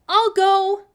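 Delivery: put-on high, loud girl's declaration; bold volunteer. A narrator's reading. Sophie volunteering in front of everyone, high and loud. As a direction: step up bravely.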